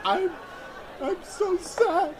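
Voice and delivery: high, slow, hammed-up sob; mock sobbing. Sobbing as he falls on the homeless guy, hammed-up. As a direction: wail it out like a big baby. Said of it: "And I started crying".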